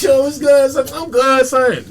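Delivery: high, loud imitation of forced cheer; putting on okay. Struggling man greeting the comic, high-pitched put-on okay. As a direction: insist you're fine in a high voice. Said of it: "And he's crying".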